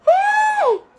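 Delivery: high, loud whoop of real joy; excited yelp. Real, not acted. Grabbing the mango in the game, a real excited whoop. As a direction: squeal it out, you just won. Cheering after getting the mango.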